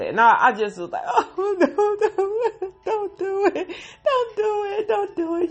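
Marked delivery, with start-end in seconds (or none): 1.1-5.5 high, laughing-crying plea; frantic pleading. Real, not acted. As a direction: beg in a high, half-laughing panic. Begging the character on screen.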